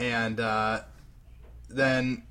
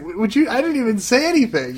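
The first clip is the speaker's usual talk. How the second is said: high, loud mock-defensive stammer; flustered protest; 10 semitones higher, 7 dB louder than usual. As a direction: imitate a boyfriend wrongly accused. Acts flustered boyfriend protesting.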